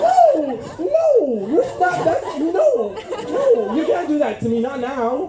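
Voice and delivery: mock begging his dad, high and laughing; mock pleading. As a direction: beg him not to be supportive, tongue in cheek.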